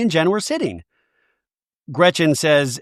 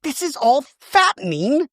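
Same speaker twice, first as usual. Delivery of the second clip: high, mocking whine on fattening; mock prissy friend; 9 semitones higher than usual. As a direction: mimic her fussy complaint. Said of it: "she's like".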